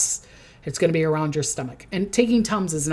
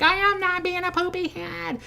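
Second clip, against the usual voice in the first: put-on childish denial; 11 semitones higher, 3 dB quieter than usual. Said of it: "It's like".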